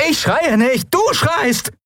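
very high, quarrelling yell; shrieked, indignant retort; angry screaming. A narrator's reading. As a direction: yell back while denying it. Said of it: "schrei mich nicht an!".

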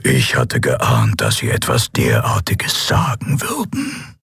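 slow, low villain voice; drawn-out, coldly polite threat; menacing armored emperor. A narrator's reading.

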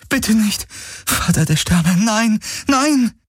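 high, anguished plea; desperate pleading. A narrator's reading. Kirai pleads as her companion collapses, desperate. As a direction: beg in rising despair.

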